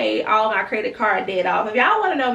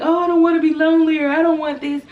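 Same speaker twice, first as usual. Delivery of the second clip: whiny self-quote; 7 semitones higher, 6 dB louder, faster than usual. Retold or imitated. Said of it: "I was just spending time like".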